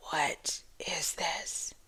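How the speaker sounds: hushed, breathless aside; stunned whisper at the snarling dog; shocked whisper. Retold or imitated. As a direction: whisper it in disbelief. Shocked reaction at the vet.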